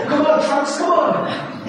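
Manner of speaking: high-pitched joking plea; hammed-up cheering on, after a laugh; mock eagerness. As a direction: playfully egg them on. Mock eagerness to get dirty.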